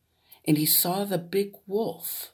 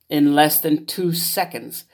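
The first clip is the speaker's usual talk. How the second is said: ordinary delivery, nothing marked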